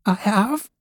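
high-pitched, protesting rabbit voice; little rabbit's cry. A narrator's reading. Toy rabbit protesting to wild rabbit, high cry. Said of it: "cried the little rabbit".

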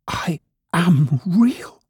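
slow, high little rabbit protest; near tears. A narrator's reading. Toy rabbit insisting to wild rabbit, near tears. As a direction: plead slowly in a small, high voice. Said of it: "he nearly began to cry".

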